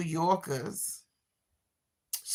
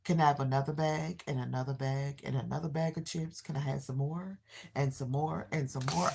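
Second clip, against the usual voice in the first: put-on quick, softer whiny kid asking again and again; imitating a begging kid; 3 semitones lower, 8 dB quieter, faster than usual. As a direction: rattle off the pestering requests. Mother mimics son begging for snacks.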